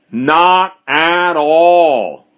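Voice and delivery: "Not at all" hugely drawn out, each word weighted; emphatic exclamation. Acted. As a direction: stretch every word of "Not at all" into a firm denial. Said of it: "with an exclamation point".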